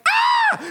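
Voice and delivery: very high piercing shriek; mock horrified scream; roommate's scream. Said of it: "when he screamed".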